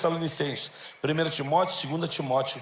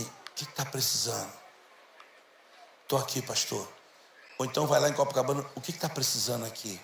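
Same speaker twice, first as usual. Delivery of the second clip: soft, humble low-voiced offer; quiet offer; 6 semitones lower, 7 dB quieter than usual. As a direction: offer help softly, unseen. Quiet helpers offering help. (voz baixa e humilde oferecendo ajuda)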